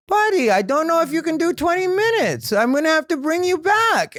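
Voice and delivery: put-on high, accented, teasing voice; Jamie's accent. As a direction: needle the young comic like a patient uncle. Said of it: "'cause Jamie has that accent".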